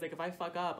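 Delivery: normal talking voice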